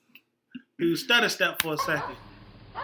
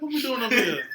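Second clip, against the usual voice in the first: voicing the dog; 6 semitones higher than usual. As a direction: play the dog, giggling. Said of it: "Dog jump up there and be like".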